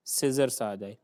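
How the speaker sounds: usual speaking voice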